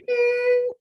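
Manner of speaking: high, sung ding like a bell; husband imitating the bell after a punch, high and ringing. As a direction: ring it out high like a bell in the head. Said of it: "I remember hearing".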